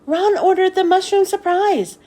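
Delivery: put-on girl bursting into the teachers' lounge, shouting; shouted. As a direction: blurt the news out loud. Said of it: "Bebe shouted".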